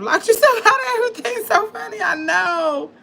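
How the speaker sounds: high, fast, laughing through words. Real, not acted. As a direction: giggle the words out. Laughing at own lockout.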